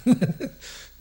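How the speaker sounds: mock-flustered, laughing through it; lightly voiced scared Chico, laughing; nervous laughing. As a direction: hurry the words out with a nervous laugh. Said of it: "olhou e falou".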